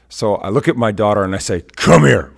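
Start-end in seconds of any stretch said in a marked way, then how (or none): 1.6-2.3 firm call. Retold or imitated. Dad calling his daughter over.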